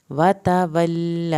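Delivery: "وتولی" slow, with the final a drawn out; stretched vowel. Shown as a demonstration. Teacher to students: slow recitation, long last vowel. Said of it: "کھڑی زبر کو کھینچ کے پڑھیں".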